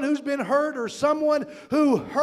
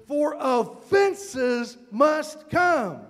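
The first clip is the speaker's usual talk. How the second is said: slow scripture reading; much slower than usual. Acted. Pastor slowly reading Matthew's warning to the church. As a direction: drag out each word of the verse.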